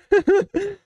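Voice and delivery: short, high-pitched burst of giggles. Real, not acted. Streamer struggling to answer in English, giggling. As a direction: crack up mid-sentence.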